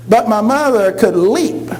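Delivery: ordinary delivery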